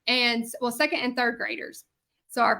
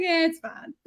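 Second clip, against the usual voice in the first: amused brush-off; 5 semitones higher than usual. Retold or imitated. Retold friend brushing it off, amused.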